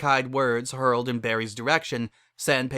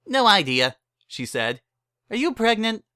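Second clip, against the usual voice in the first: brassy saleswoman; 10 semitones higher, 4 dB louder than usual. Acted. Barry pitches her wedding packages to the couple, brassy and higher. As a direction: rattle off the package menu like a hustler.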